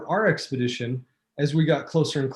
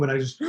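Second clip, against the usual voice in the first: sharp high gasp for breath; gasp for air; much faster than usual. Retold or imitated. Telling the host how the summit hit, with a sharp gasp. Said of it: "and I couldn't breathe".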